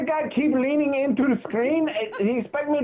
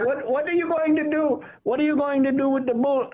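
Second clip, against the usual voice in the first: put-on comic Middle Eastern accent, a bit more pushed; [name] quoting himself. [name] retelling his own question to the neighbor, in a comic Middle Eastern accent. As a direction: ask it in the held [name] accent. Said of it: "[name] was like".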